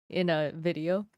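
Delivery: ordinary talk, nothing marked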